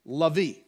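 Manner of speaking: Levi said LEH-vee; Levi with Spanish vowels. Shown as a demonstration. Preacher repeating the Spanish name. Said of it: "pronounced differently".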